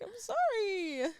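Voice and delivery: high, slow little apology; mock-pitiful squeak; meek apology. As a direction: stretch it out in a small sheepish voice. Acts out her meek apology.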